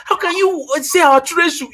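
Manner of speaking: high, upset mock outrage; retold angry wife. Husband retelling his wife's upset reaction, high mock outrage. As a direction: imitate her indignant protest. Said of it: "She was angry with me".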